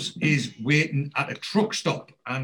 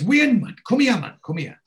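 urgent call; 9 semitones higher, 5 dB louder than usual. Retold or imitated. Oz calls Wayne from behind gravestone.

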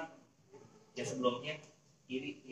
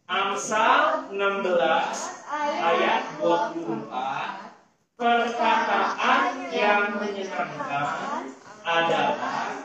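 loud, slow memory verse; slow, chanted verse at full voice; loud group recitation; 3 semitones higher, 6 dB louder, much slower than usual. Real, not acted. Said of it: "dengan suara yang keras".

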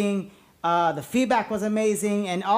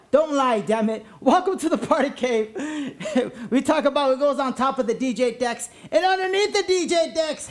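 energy drink ad; 4 semitones higher than usual. Acted. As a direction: shout it like a party host. Podcast intro hype host.

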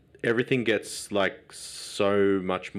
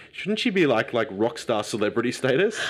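ordinary talk

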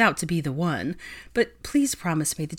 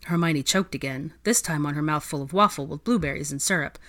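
usual speaking voice

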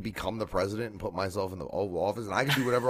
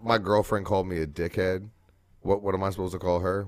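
ordinary talk

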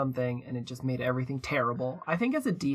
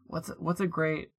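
normal talking voice

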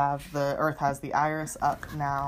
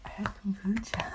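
whisper; 3 semitones higher, 4 dB quieter than usual. Real, not acted. Excusing a burp.

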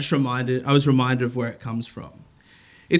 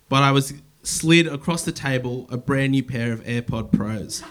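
normal talking voice

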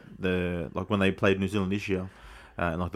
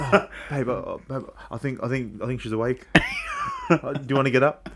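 drowsy mock wake-up whisper, higher; fake sleepy voice; 5 semitones higher than usual. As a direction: play half-asleep nudging partner. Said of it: "you do the fake sleep".

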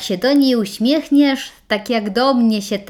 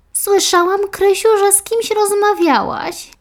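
put-on much higher, sing-song old mother's call; mother calling out for her daughter; 11 semitones higher than usual. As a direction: call for your daughter through the house, higher. Mother voiced by narrator.

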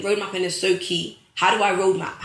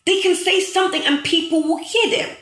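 rising fervour; 8 semitones higher than usual. Real, not acted. Telling followers influencers get heard, rising fervour. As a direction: lift the line like a preacher, rising at the end. Repeats point, voice climbing.

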